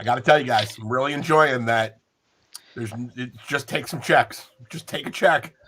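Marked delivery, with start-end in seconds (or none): none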